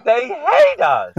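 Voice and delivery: high, slow wailing; mock wail. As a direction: cry it out high and drawn.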